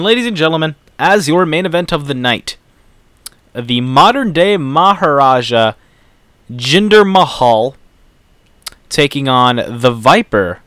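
slow, drawn-out wrestling announcer; ring announcer. Acted. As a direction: build up the main event like a ring announcer. Announces the main event.

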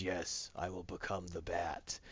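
low, slow, soft brooding vow; mock-ominous low murmur; Batman. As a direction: put on a dark hero voice, drawn out and quiet. Batman vowing over the corpse.

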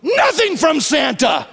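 put-on a two-year-old's defiant yell, drawn out; toddler's angry shout. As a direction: shout it like a furious little girl refusing. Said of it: "and she goes".

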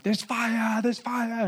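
high, urgent shout of alarm; panicked cry. Retold or imitated. Girls shouting warning as fire comes raging. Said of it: "I hear the girls freaking out".

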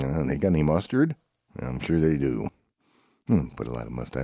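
ordinary delivery

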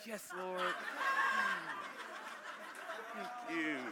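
soft, restrained praise; hushed, mock-reserved thanksgiving; subdued excitement. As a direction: praise quietly under your breath. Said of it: "maybe your excitement is".

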